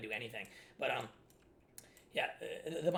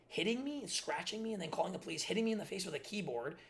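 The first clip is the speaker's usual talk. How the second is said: ordinary delivery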